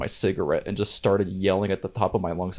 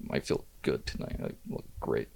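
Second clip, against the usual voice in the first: whispered; 11 semitones lower, 13 dB quieter than usual. Retold or imitated. Drunk self retold, whispered.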